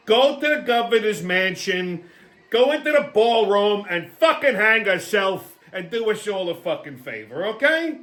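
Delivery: ordinary talk, nothing marked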